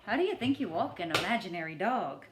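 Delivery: usual speaking voice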